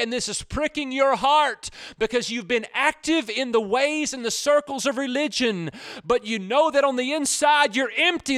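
loud, high fiery preaching; preaching at full force. Acted. Pastor pleading with listeners who feel empty inside. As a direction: lift the voice and drive the warning home.

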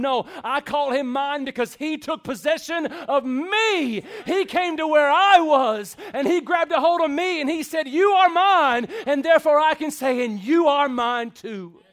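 loud, high preaching at full force; impassioned preaching. Real, not acted. Preacher telling the congregation who claimed him, impassioned preaching.

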